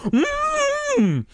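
long, very high gagged hum; drawn-out closed-mouth wail; pleading muffled cry. A narrator's reading. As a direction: plead through a gag. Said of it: "Their father continued to plead".

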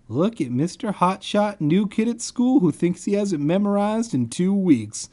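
high-pitched sarcastic jeer; mocking. Reader mocking the new kid hero, sarcastic. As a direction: needle the know-it-all in a high voice.

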